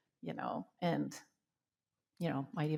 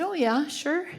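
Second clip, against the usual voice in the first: high, louder, chirpy yes; bright eager agreement; 9 semitones higher, 5 dB louder, slower than usual. Retold or imitated. Spiritual leader agreeing to meet, brightly eager.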